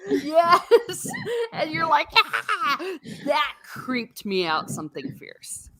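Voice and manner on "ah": high-pitched mock yelp of fright; mock scream. Viewer seeing the vampire face, mock frightened scream. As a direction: play a startled viewer's scream.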